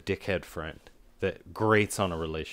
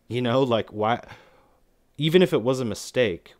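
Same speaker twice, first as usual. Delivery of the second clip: usual speaking voice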